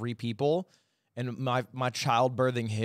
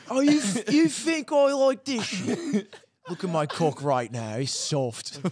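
hammed-up accent, starting high and drawn out, then dropping; laughing through a bad TV impression; The Boys character; 5 semitones higher, slower than usual. Teasing a friend with the guy from The Boys, mock accent. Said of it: "it moved to the main character from The Boys".

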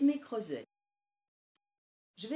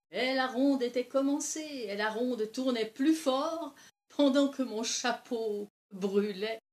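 loud, higher telling, easing on the last word; animated old storyteller; 5 semitones higher, 11 dB louder than usual. Acted. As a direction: sweep along with the whirling dance. Old Lise ends her animated tale. (vieille conteuse emportée, voix forte qui retombe à la fin)

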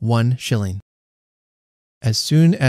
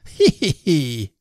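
high, forced "he he he" giggle; smirking titter; 3 semitones higher, much slower than usual. A narrator's reading. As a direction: titter at your own joke. Smirking man laughs at joke.

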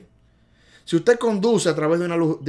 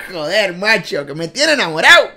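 louder, higher mock Spaniard in love; Spain accent; 3 semitones higher, 8 dB louder than usual. Teacher gushing about his friend in a mock Spanish accent, louder. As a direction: gush like a lovestruck Madrileño. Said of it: "se me ha quedado el acento".

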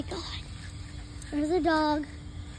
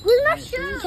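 high loud call, pitched way up; yelling across the room; 9 semitones higher, 4 dB louder than usual. Real, not acted. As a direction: shout the question to someone far off. Calling out for shoes again.

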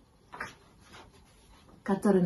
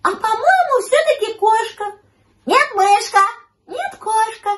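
very high, loud cartoon voices; high-pitched, insistent bickering; squabbling little animals; 13 semitones higher, 8 dB louder than usual. A narrator's reading. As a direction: argue in a high, piping animal voice. Puppy and kitten argue cat or mouse.

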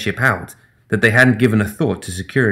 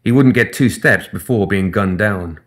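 ordinary delivery

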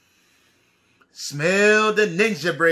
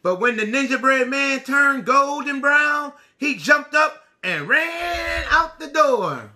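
storybook read-aloud for the class; lively read-aloud for children. A narrator's reading. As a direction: tell it like a picture book to little kids. Reads picture book aloud.

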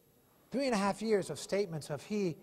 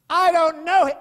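much louder and higher; angry denial; 10 semitones higher, 17 dB louder than usual. Retold or imitated. Peter's third denial by the fire, loud angry outburst. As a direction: spit out the denial.

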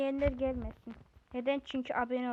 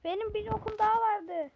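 same line pitched up; raised-pitch repeat of the line; higher retake; 7 semitones higher than usual. Shown as a demonstration. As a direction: say it again, higher. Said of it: "repeated line".

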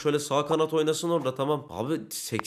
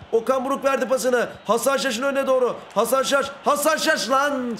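excited, higher-pitched attack commentary; live play-by-play; 7 semitones higher than usual. Pretend. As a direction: call the run forward like a match commentator. (heyecanlı atak anlatımı)

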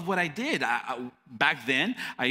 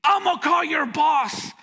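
higher, louder angry threat; threatening shout; 12 semitones higher, 6 dB louder than usual. Retold or imitated. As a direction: threaten to go over his head. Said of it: "when he was screaming at me".

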